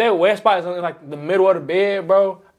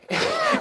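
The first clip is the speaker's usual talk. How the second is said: high burst of laughter; 6 dB quieter than usual. Real, not acted. Laughing at own story.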